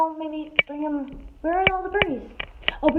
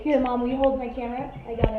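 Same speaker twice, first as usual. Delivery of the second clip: usual speaking voice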